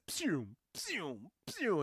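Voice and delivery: soft, high "pew" shots; ray gun noises. Retold or imitated. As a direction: imitate little laser blasts. Imitating the return ray's shots.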